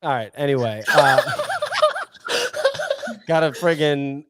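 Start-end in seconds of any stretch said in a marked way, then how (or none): 2.2-3.2 high burst of laughter. Real, not acted. Laughing at fake accent.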